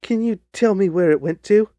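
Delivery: high, loud, urgent plea; emotional cry. Acted. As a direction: beg for news, overcome with emotion. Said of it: "Ryder quivered with emotion".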